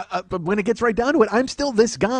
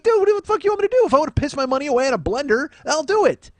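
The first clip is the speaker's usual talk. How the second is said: high-pitched, mock-indignant comeback; exasperated retort; 7 semitones higher than usual. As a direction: protest in an incredulous, raised pitch. Acted exasperated retort to the writer.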